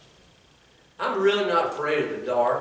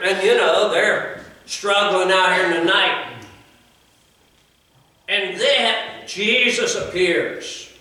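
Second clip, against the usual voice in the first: high, loud preaching build; preaching at full force; 6 semitones higher, 8 dB louder than usual. Acted. Preacher driving the story to his congregation, full force. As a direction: preach it out to the pews.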